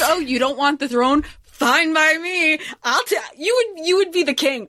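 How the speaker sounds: high, hammed-up squeaky king; a silly high-pitched parody of the king; mock king. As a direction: send up the smug old king, way up high. Mocking the king's reply.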